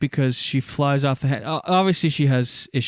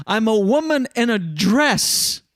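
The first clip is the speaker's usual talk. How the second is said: hammed-up Karen; 7 semitones higher than usual. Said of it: "another ditch att".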